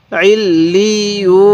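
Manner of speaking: "আইল লি ইউ" slow, doubled l and long final oo; doubled letters. Shown as a demonstration. Teacher showing learners how to read joined letters, slow drawn-out syllables. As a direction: sound out "আইল লি ইউ", stretching each joined syllable. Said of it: "এরকমভাবে শিখব".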